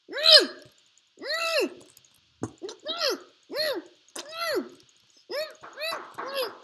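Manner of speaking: high loud humming; teasing hums to an opponent; high mock hum.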